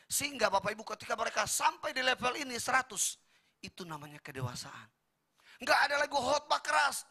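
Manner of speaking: loud, high preaching push; fiery preaching. Acted. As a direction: preach it hard to the congregation. Preaching at full force. (khotbah berapi-api, keras dan tinggi)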